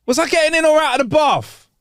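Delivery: calling out confused from the bath; mock yell up the stairs. A narrator's reading. Old brother calls down the stairs, high mock yell. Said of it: "Then he yells down the stairs".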